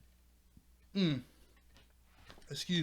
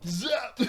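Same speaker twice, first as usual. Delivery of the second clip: a high, loud mock zap; zap sound effect; 10 semitones higher, 6 dB louder than usual. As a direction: blurt the noise of a laser blast. Imitating the clone's zap attack.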